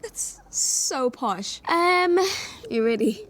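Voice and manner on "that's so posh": a lazy, dragged-out amused remark; a slow, savoured "so posh"; slow drawn-out posh. Retold or imitated. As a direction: stretch "That's so posh" out slowly. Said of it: "I'm like".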